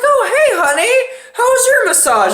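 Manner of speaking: high, dreamy, relaxed "hey guys"; mock-blissful girlfriend voice; blissed-out girlfriend. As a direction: play the girlfriend floating out of a lovely massage. Girlfriend voiced after massage.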